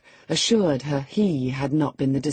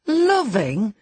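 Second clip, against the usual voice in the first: a louder, higher, mocking "Loving?"; scornful; 7 semitones higher, 5 dB louder than usual. A narrator's reading. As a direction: sneer at the word. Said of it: "as scornfully as I could utter the word".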